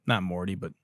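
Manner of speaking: ordinary talk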